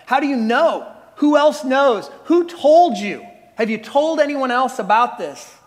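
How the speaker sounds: mock-panicked interrogation; alarmed questioning. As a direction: fire off questions in acted alarm, building in pitch and volume. David's flood of alarmed questions.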